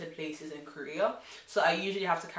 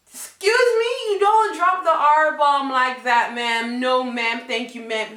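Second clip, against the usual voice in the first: much higher, loud indignant rebuke; sassy shocked telling-off, slowing on the R-bomb; outraged scolding; 6 semitones higher, 9 dB louder, slower than usual. Retold or imitated. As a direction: call her out, scandalised. Friend calls restaurant staff racist.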